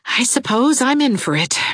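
put-on resigned young woman; resigned despair. A narrator's reading. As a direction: give in reluctantly. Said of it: "with a shrug of despair".

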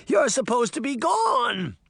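high-pitched panicked protest; horrified cry. A narrator's reading. Deputy to the returned Horseman, crying in horror. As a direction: protest in terror, voice shooting up. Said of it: "the deputy cried in horror".

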